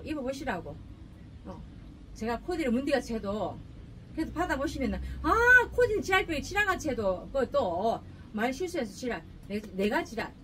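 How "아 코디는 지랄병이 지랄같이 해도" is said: imitating a customer. Imagined customer's reaction quoted.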